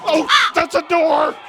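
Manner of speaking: yelling in fright through the maze; scared yelling. Real, not acted. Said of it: "I'm scared".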